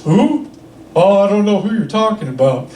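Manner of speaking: lightly voiced Hank Hill, puzzled; Hank Hill impression. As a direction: Hank Hill voice, barely put on. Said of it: "this guy sounds exactly like Hank Hill".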